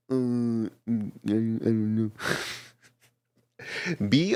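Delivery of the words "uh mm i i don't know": mock stuck-up hesitation. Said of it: "act all stuck up and all like".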